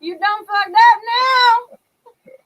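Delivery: high, loud sassy "hell no"; sassy outrage. Pretend. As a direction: play an outraged sassy diva. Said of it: "making a reference to, the Family Guy episode".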